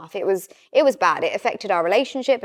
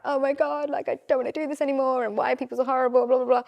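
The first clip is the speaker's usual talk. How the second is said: retold crying; 5 semitones higher, faster than usual. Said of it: "be crying".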